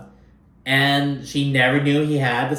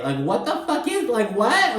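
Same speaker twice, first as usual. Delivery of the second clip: indignant disbelief; 10 semitones higher than usual. Retold or imitated. Outraged retelling own reaction.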